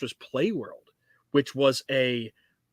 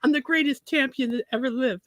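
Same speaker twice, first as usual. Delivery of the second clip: high boasting dad impression; Tyson-style boast; 9 semitones higher than usual. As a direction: brag like a champ boxer. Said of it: "he was like".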